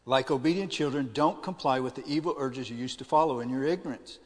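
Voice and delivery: loud, emphatic Bible reading; scripture reading. Acted. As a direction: proclaim the verse to the congregation. Rereads verse aloud.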